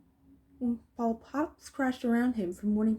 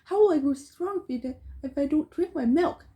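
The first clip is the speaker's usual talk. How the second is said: put-on high, louder indignant boy; protesting boy; 7 semitones higher, 4 dB louder than usual. A narrator's reading. Robbed boy scolds the bandits, indignant. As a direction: whine about your stolen milk. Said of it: "I protested".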